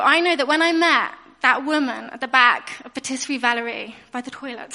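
ordinary talk

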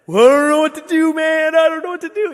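high, loud, frantic celebratory yell; retold yelling. Preacher reenacting fans' outburst at the Bucks win, yelling. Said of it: "yelling, screaming".

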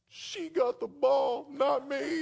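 very high, drawn-out mock wail; crying child. Little girl upset teammate got the ball, imitated crying. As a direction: imitate a little girl whining through tears. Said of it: "that's what she was crying about".

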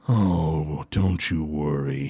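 low, soft, slow menace; villain's low soothing threat; sinister villain. Acted. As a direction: purr it low and quiet. GM voices Groon, menacing.

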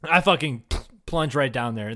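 ordinary delivery

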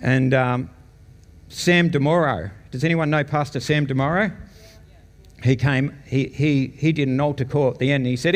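usual speaking voice

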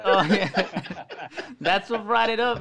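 chuckling through the words; laughing. Real, not acted. Laughing while recalling.

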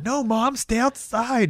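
put-on high-pitched boy calling to mom; mock panicked kid. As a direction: imitate a kid urgently warning mom away. Real kid frantically countering the imitation.

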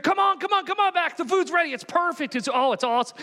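high, eager beckoning; hammed-up host pulling the guest to the table. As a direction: wave them in like an overjoyed host. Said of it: "We're gonna".

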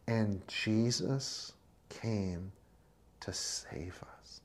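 slow whisper. Acted. Preacher whispering the gospel to the congregation, slow. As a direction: breathe it like a sacred secret. Hushed climax of sermon.

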